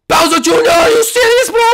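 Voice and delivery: high, loud cry of disbelief; shocked outburst. Acted. Chef learning of the surprise date, shocked shout. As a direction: explode in alarm at the news. Chef Mario reacts to news.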